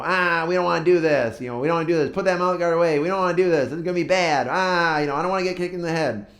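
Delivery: high-pitched imitation of protesting kids; mock a gaggle of complaining children; whining kids. Troops protest the sparring.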